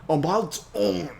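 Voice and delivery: high, loud, slow character take. Shown as a demonstration. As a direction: lean into the character voice. Retry in character voice.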